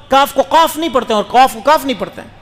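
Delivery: usual speaking voice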